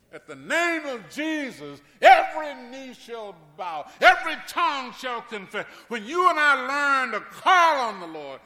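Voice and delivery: loud, high preaching; rising gospel preacher call; full-force preaching. Acted. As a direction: preach it out to the church.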